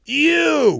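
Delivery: sharp high yell from the bar. Retold or imitated. Bartender heckling the singer, high loud yell. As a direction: shout back one word across the room. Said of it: "guy behind the bar yells".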